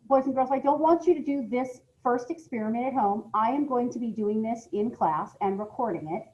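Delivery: usual speaking voice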